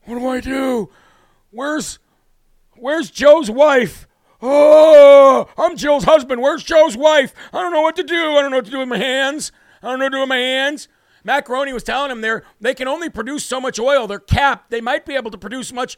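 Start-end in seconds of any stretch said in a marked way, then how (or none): 0.1-10.9 mock confused old man, a bit higher; hammed-up dithering, lost and fumbling; imitating Biden. Mocking the president as a helpless old man. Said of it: "He was just like this".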